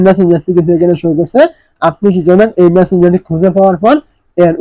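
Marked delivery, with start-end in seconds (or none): none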